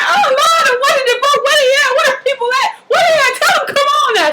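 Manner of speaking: very high, loud mock-hysterical shrieking; hammed-up frantic wail; freaking out. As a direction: imitate a mother panicking for help to come. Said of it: "She freaking out at this point".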